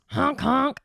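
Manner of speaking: high, loud honking sound; mock clown honk. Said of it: "honk my titties".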